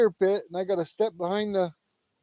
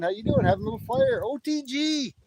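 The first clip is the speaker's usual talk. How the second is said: cheery greeting; 10 semitones higher than usual. Real, not acted.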